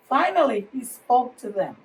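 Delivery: ordinary delivery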